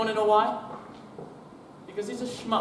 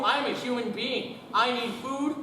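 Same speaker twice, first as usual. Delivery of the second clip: ordinary talk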